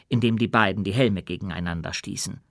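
usual speaking voice

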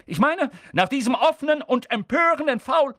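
high, loud sports commentary; indignant match commentator. Acted. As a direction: commentate the foul with open outrage. Excited match commentator in the story.

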